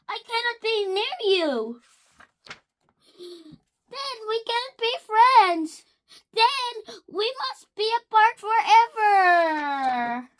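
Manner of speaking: hammed-up heartbroken goodbye; dramatic farewell. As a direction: play the tragic parting, hammed up. Gerald dramatically parting from Piggy.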